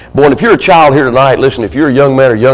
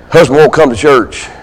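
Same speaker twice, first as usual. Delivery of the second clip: normal talking voice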